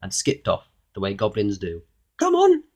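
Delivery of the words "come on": very high, loud goblin call. A narrator's reading. Goblin calling over his shoulder to Mister Uppity, high voice. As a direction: call back to a follower in a squeak. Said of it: "he called over his shoulder".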